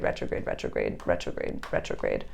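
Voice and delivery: playful fading whispered repeats; whispering. Pretend. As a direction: whisper it away into silence.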